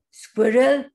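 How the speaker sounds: loud searching call. A narrator's reading. Bear seeking the hidden squirrel, loud call.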